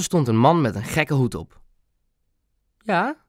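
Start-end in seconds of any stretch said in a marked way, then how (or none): 2.8-3.2 high, odd little squeak; squeaky little voice. A narrator's reading. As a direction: answer the door in a strange high piping voice. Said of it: "met een vreemd piepstemmetje". (vreemd, hoog piepstemmetje)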